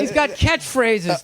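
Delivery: high, drawn-out comic complaint; mock exasperation. Acting out an exasperated audience member.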